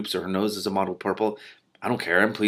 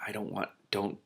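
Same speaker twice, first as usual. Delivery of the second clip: whispered plea; hushed dreading plea; 10 dB quieter than usual. Real, not acted. Reader dreads Hattie.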